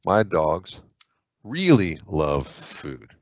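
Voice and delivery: slow, deliberate model sentence; teacher spelling out an example sentence; slow example read. Shown as a demonstration. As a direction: read it out slowly, word by word. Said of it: "example sentence read slowly".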